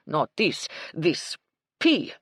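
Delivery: put-on French accent. A narrator's reading. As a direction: spit out pea in a French accent. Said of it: "a strong French accent".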